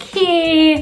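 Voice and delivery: high, long-held HEY; fun drawn-out hey. Shown as a demonstration. Greeting as a jolly person who loves life, fun drawn-out hey. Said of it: "hey with two Y's or more ... usually very fun".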